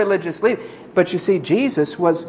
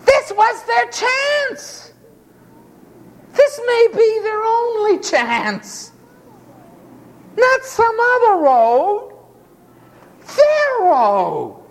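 high, slow, urgent proclamation; intense preaching; slower than usual. Acted. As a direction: hammer home the one chance. Preacher stresses their chance.

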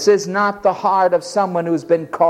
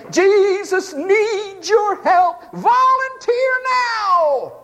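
much higher, slow, mocking recruiter plea; recruiting pitch; much slower than usual. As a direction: parody a mission society appeal. Parodying mission recruiters.

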